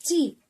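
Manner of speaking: ची said as one short, quick syllable. Shown as a demonstration. Teacher modelling the correct reading for children, a quick short ची. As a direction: clip the ई in ची short. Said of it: "थोड़ा जल्दी पढ़ना है".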